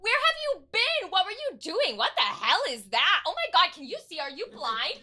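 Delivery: loud, high rapid-fire questioning; excited girl's barrage. Acted. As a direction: pelt them with questions at full volume. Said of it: "she just keeps going".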